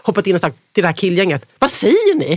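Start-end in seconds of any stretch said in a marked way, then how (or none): 1.6-2.3 imagined protest, higher and a bit louder; indignant confrontation. Pretend. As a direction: challenge them in a friend's defence. Imagined friends confronting the gang.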